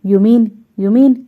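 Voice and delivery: stunned disbelief. A narrator's reading. Manager caught off guard by a refusal, slow stunned disbelief. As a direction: let the words hang in amazed disbelief. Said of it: "ఈసారి manager చాలా ఆశ్చర్యపడ్డాడు".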